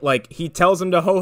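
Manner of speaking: ordinary talk, nothing marked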